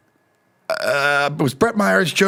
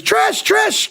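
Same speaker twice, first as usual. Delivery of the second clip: calling out. Retold or imitated. Brother-in-law calling out on phone.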